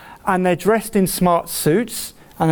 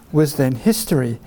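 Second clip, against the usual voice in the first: ordinary talk, nothing marked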